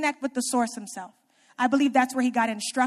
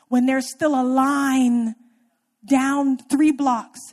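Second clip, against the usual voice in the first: slow emphasis; 6 dB louder, much slower than usual. Real, not acted. Drawn out emphasis.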